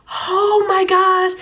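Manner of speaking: high, drawn-out dismay; mortified. Retold or imitated. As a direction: stretch it out in mock horror. Mortified as class laughs.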